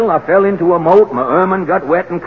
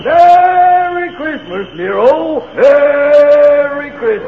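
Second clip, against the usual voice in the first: high, slow booming Santa call; jolly Santa greeting; 9 semitones higher, 3 dB louder, much slower than usual. Acted. Santa's hearty greeting down chimney.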